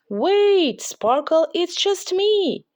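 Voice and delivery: put-on little girl calling, wait; calling after him. A narrator's reading. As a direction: call out to reassure a scared friend. Lucy calls after fleeing unicorn.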